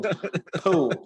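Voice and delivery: a panhandle "Poo" with the L swallowed; Florida panhandle poo. Shown as a demonstration. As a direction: echo "Poo" with a laugh in it. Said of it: "my Florida panhandle accent".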